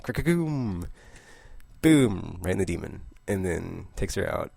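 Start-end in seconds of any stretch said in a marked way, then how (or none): 0.0-0.9 thunder crack sound effect. Retold or imitated. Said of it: "It's like".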